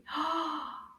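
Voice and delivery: soft theatrical gasp; mock surprised gasp. Teacher pretends to discover the rhyming words, mock surprised gasp. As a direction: gasp as if the answer just arrived.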